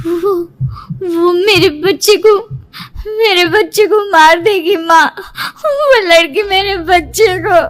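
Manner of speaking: sobbing, halting words; crying out of a nightmare; tearful panic. Acted. Dazed daughter tells mother of the dream, crying.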